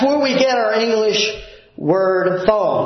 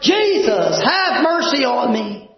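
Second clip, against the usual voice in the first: mock yell; 5 semitones higher than usual. Preacher acting out lepers yelling to Jesus from afar, mock yell. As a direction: call out for mercy across a distance. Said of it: "They were yelling at the top of their voice".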